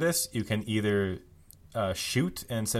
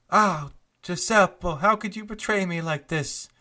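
betrayed Loriana; 8 semitones higher, 4 dB louder than usual. Acted. GM voicing Loriana to the traitor Giuseppe, high hurt plea. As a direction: ham up Loriana's heartbroken accusation. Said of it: "and says".

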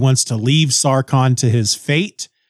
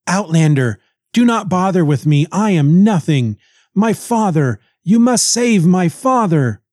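lightly acted desperate plea, pitched up; hammed-up damsel's appeal; pleading girl; 5 semitones higher than usual. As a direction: plead dramatically for your father.

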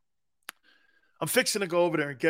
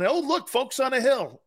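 a light Southern twang, pitched up; wife's Southern saying; 8 semitones higher than usual. Retold or imitated. Mimicking his wife's Southern phrase, light Southern accent. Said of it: "my wife will say something".

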